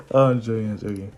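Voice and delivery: usual speaking voice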